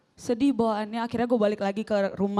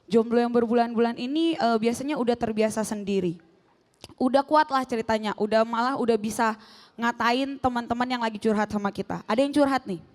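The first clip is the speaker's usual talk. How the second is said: normal talking voice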